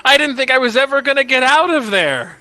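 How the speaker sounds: high, loud exclamation of relief; relieved prince. Acted. Prince just freed from his curse, high relieved outburst.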